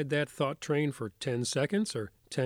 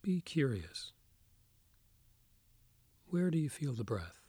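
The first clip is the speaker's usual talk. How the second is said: soft spa whisper; 4 dB quieter than usual. Acted. Leads a guided meditation.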